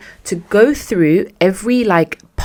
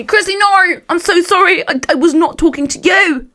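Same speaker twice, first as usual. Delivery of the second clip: high, louder imitation of a pleading boss; mock frantic apology; 11 semitones higher, 4 dB louder than usual. Boss backtracking to an offended staff member, in mock frantic pleading.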